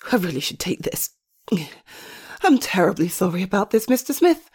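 usual speaking voice

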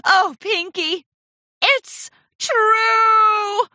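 high, loud drawn-out wail; slow theatrical lament; melodramatic cry. A narrator's reading. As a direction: wail the confession like a drama queen. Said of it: "Rarity cried".

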